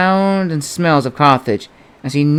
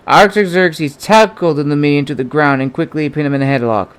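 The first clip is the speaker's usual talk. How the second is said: usual speaking voice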